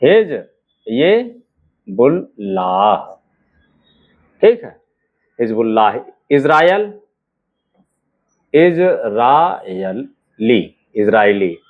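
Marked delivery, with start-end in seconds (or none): 0.0-3.0 slow हिज... बुल... लाह with long gaps; drawn-out dictation, last syllable लाह held low. Shown as a demonstration. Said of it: "देखिएगा". 8.5-10.6 very slow Israeli, pauses between its parts; drawn-out dictation of Israeli; syllable by syllable. Shown as a demonstration. Word dictated in pieces.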